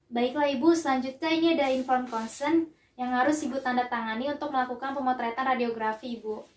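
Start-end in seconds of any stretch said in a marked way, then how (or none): none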